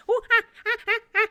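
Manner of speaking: high, loud chattering monkey scold. Acted. Monkey scolds the pirate, high scolding chatter. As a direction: tell him off in monkey chatter. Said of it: "as if telling him off".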